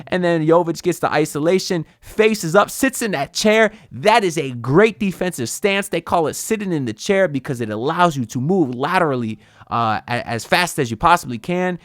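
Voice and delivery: real excitement running away with you, pitch climbing; too excited. Real, not acted. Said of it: "I'm getting too excited".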